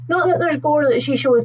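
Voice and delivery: ordinary talk, nothing marked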